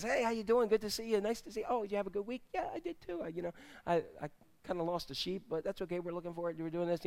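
soft, low-key chit-chat in an acted aside; casual small talk. Ordinary congregant greeting people as he sits down, quiet small talk. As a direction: murmur friendly small talk under your breath.

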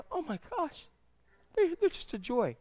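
hushed, high-pitched parental wonder; tender awe. Acted. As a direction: coo very softly over a newborn. Parent holding newborn, awed.

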